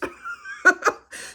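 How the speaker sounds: high burst of laughter. Real, not acted. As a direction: laugh at your own story. Laughs at her own story.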